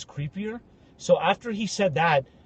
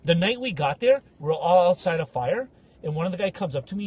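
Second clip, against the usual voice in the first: ordinary talk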